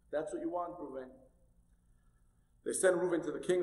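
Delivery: normal talking voice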